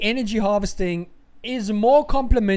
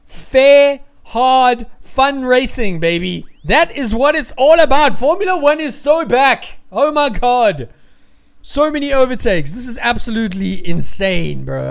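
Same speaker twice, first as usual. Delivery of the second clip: loud and higher, hyped up; excited cheering; 4 semitones higher, 5 dB louder than usual. Real, not acted. Streamer hyped about all the overtakes, cheering. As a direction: gush like the sport is back. Celebrating exciting Formula One racing.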